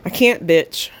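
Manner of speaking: ordinary talk, nothing marked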